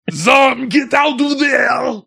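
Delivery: high, slow drawn-out shout; yelling. Retold or imitated. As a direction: holler desperately at a friend. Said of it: "I'm yelling at Zum".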